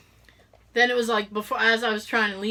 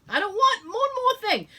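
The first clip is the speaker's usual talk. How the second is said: much higher, near-whining repeat; higher exasperated wail; 11 semitones higher than usual. Retold or imitated. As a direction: wail it, fed up.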